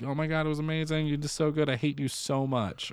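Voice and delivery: fast, high, over-the-top fan gush; breathless mock rave; mock gushing praise. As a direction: rattle it off like a gushing audience member. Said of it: "people were like".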